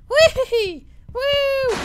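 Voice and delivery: very high, loud giggly whoops; gleeful squeal. Pretend. Old man riding the machine, gleeful squeal. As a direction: squeal with mock glee.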